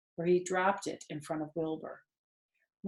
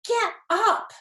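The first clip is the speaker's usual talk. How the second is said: much higher, loud shout; scream; 5 dB louder than usual. Acted. Wilbur screaming at the lazy rat to get up. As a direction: yell it at him to move. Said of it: "screamed Wilbur".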